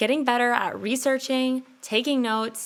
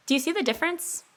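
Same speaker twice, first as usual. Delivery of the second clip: ordinary talk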